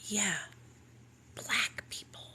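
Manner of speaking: a secretive whisper; whispered aside. Retold or imitated. Sponsor whispering her prejudice across the lunch table. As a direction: drop to a conspiratorial whisper.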